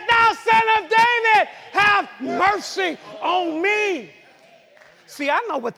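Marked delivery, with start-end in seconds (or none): 0.1-4.1 high, loud drawn-out plea; acted desperate cry, easing at the end; crying out more. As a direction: holler for mercy over the crowd. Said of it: "he cried even more".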